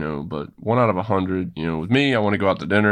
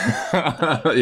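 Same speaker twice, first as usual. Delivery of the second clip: amused laugh; 8 semitones higher than usual. Real, not acted. Laughs at being teased.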